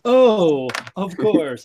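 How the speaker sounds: loud, high exaggerated "oh"; mock realisation. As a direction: play the official suddenly convinced. Acts officials conceding to teen.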